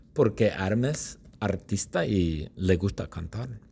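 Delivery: ordinary delivery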